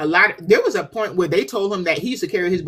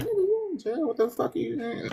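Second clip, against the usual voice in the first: inner voice; 8 semitones higher, 8 dB quieter than usual. Retold or imitated. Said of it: "in my head, I'm like".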